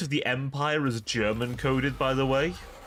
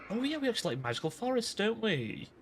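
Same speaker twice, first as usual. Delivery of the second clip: cooing mock delight; 9 semitones higher, 5 dB quieter, faster than usual. Mock cooing over magical forests.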